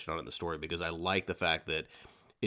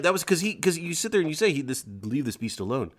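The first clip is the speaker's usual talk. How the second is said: excited; 8 semitones higher, 4 dB louder than usual. Real, not acted. Podcast host worked up about the beast scene, excited. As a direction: voice jumps high and louder, worked up.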